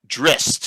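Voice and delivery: Drizzt rhymes with pissed; pronunciation. Shown as a demonstration. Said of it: "To rhyme with pissed".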